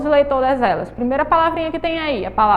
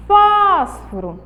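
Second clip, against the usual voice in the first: Fósforo with "Fós" high, loud and held; stressed first syllable; 6 semitones higher, 4 dB louder, slower than usual. Shown as a demonstration. Teacher shows the antepenultimate is tonic, stretched "Fós" in Fósforo. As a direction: punch and stretch "Fós", let "foro" fall away. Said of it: "antepenúltima, a sílaba é tônica".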